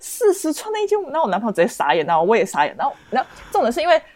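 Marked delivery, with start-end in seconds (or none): 0.0-1.1 四十寸 called out high by the host; lightly voiced host naming the prize 四十寸的液晶萤幕; a hint of an auctioneer announcing the item. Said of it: "主持人说".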